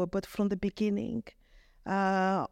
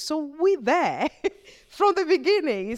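laughing; 7 semitones higher, 5 dB louder than usual. Real, not acted. Laughs at long history.

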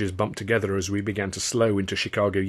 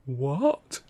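croaked; 6 semitones higher than usual. Acted. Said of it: "I croaked".

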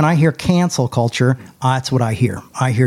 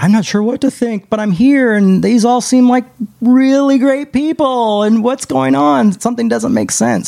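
put-on confused teen voice; 10 semitones higher, 3 dB louder than usual. Confused teen inner voice, retold.

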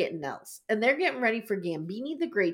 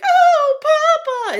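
much higher, louder hammed-up wail; mock wailing mother; 9 dB louder, much slower than usual. Mother crying at husband's bedside, hammed-up wail. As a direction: overwrought Italian mama crying out. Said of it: "And Mama's like".

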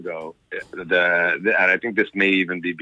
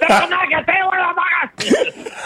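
high, loud Quebec-French swearing rage; furious shout; 4 dB louder than usual. Retold or imitated. Father screaming at his kids over missing toothpaste, furious shout. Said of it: "I lost my fucking mind".

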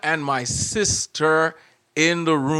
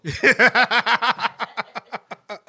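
laugh; 8 semitones higher, 4 dB louder than usual. Real, not acted. Teller laughs at own punchline.

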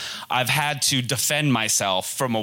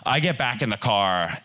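ordinary talk

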